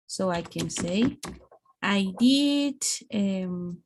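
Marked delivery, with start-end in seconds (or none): 1.8-2.8 DID drawn out and raised; emphatic DID. Shown as a demonstration. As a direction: stretch "did" to insist on it. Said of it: "this is for emphasizing".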